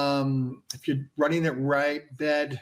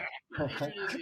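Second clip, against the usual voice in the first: soft amused chuckle; laugh; 4 semitones higher, 9 dB quieter than usual. Real, not acted. Owner answering a lot of money, laughing. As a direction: chuckle before explaining the costs.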